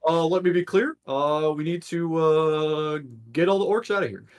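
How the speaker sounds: hammy Obama speech cadence; Barack Obama impression. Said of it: "what if I do an accent of Barack Obama?".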